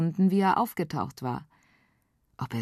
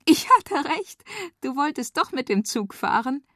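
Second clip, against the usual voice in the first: put-on excited girl; 10 semitones higher than usual. A narrator's reading. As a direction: crow with delight. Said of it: "Feli lachte auf".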